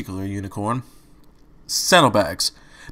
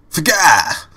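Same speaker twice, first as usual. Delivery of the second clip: startled yelp; 5 semitones higher, 5 dB louder than usual. A narrator's reading. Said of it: "was the unconventional reply".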